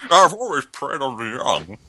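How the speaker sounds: slow, imitated Bane villain voice. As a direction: mimic a slow Bane growl. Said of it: "You sound kinda like Bane".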